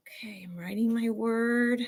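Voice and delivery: whispered while writing. Pretend. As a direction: hush it like a child writing carefully. Acting out quiet concentration.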